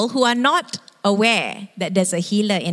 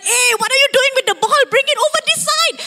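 much higher, louder, fast yelling at the TV; football fan shouting; 3 dB louder, faster than usual. Retold or imitated. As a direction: yell at the players on screen. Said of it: "And you're shouting".

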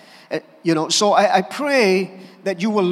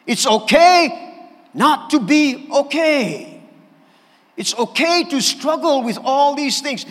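preaching emphasis; 5 semitones higher, 4 dB louder, slower than usual. Acted.

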